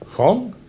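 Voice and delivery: Hung swooping high upward; rising tone. Shown as a demonstration. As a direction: shoot Hung up in pitch.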